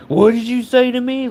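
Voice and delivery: high-pitched indignant challenge; mock offended. Irrational man challenging a passerby, high-pitched mock offense. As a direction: imitate someone taking instant personal offense. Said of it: "take it as a personal offense".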